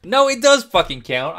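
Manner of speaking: loud, high, insistent rebuttal; indignant objection. Real, not acted. Player disputing partner's ruling on the answer, indignant shout. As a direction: protest loudly, pitch jumping up.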